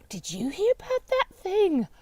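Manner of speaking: high, slowed-down gossip voice; gossiping client. Retold or imitated. Client sharing the latest news, put-on gossipy voice. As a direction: mimic a friend eagerly passing on news. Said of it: "from talking to my clients or to friends".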